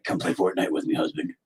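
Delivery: wife inviting him to game, low soft voice; mock wife. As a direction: say it flat and low like a tired wife. Imitating wife.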